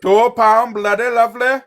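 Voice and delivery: put-on high-pitched comic Easter East accent. Streamer showing off an impression to friends, Easter East accent. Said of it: "Wait until I do my Easter East".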